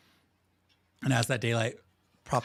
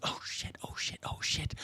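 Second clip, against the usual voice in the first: hushed, unvoiced muttered swearing; acted frantic whisper; panicked whisper; 8 dB quieter than usual. Panicking actor overheard behind curtain.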